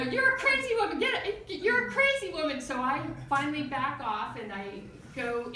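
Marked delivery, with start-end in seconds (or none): 0.0-2.6 high, loud mock shrieking accusation; retold hysterical outburst, pitched way up; imitated screaming. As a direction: yell it like an outraged woman losing it. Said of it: "She's screaming".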